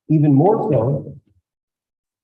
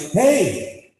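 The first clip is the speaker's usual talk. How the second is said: raised, high, steady commands; firm calming dad; 8 semitones higher, slower than usual. Retold or imitated. Dad calming his wife and hurt son, raised and firm. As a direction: settle everyone down firmly. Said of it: "I'm like".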